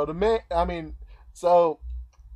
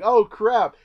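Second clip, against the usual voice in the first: higher, louder eager pleading; excited realization; 8 semitones higher, 7 dB louder than usual. Retold or imitated. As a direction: sell it like you've found the answer. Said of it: "He was like".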